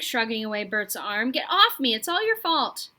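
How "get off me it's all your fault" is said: higher, louder tearful blame; sobbing. A narrator's reading. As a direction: sob it out, accusing. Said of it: "sobbed Roderick".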